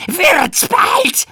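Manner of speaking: high, loud impatient demand; venomous shout. Acted. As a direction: snap it spitefully. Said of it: "keifte der Drache giftig".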